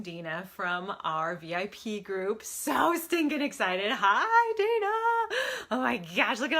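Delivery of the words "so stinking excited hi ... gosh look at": excited greeting. Real, not acted. Host welcoming her guest Dina, gushing and excited. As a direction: gush over the guest's arrival.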